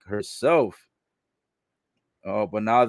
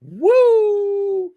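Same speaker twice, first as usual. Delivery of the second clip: loud, high, long wrestler's "woo"; Ric Flair; 7 dB louder than usual. Retold or imitated. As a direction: whoop it out high and held in tribute. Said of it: "Shout out to Ric Flair".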